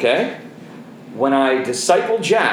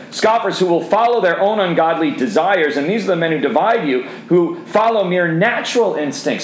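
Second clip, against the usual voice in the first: plain; 5 semitones higher than usual. Acted. Continues the scripture reading.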